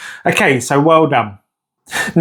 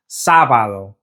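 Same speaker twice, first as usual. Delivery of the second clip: lower-pitched "Sábado", leaning on "Sá"; stress on first syllable; 5 semitones lower than usual. Shown as a demonstration. Teacher modelling for learners, "Sábado" stressed on "Sá". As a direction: press on "Sá" and let "bado" drop. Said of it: "we need to stress that first syllable".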